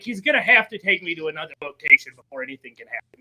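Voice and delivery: low, quick, hushed thinking to oneself; inner thought. Retold or imitated. Storyteller replaying his worry in the car, quick low inner thought. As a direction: run through it low and fast, under the voice. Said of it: "I was in my head".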